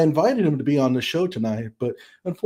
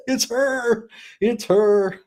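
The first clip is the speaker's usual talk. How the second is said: gleeful; 10 semitones higher, much slower than usual. Real, not acted. Gleeful reveal after the math.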